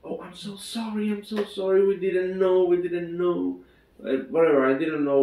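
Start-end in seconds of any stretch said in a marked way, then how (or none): 0.0-3.6 higher-pitched mock apology; apologetic wife. Retelling the wife apologizing for not knowing, high pleading voice. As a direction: imitate a pleading, repeated sorry. Wife's frantic apology retold.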